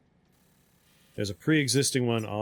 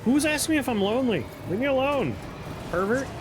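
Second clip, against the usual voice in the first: high, fast squeaky VTuber voice; cute anime streamer; 10 semitones higher, faster than usual. Acted. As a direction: squeal it like a flustered anime girl. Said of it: "makes us talk like a VTuber".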